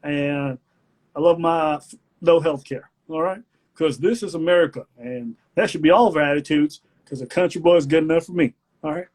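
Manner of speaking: put-on hamming up a country boy's speech; mock Southern speech. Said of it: "and I'm like".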